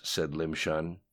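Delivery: usual speaking voice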